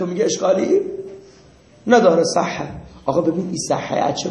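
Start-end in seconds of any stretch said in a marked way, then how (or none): none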